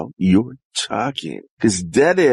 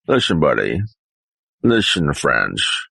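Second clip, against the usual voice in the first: ordinary talk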